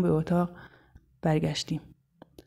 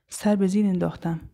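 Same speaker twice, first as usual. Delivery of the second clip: plain reading voice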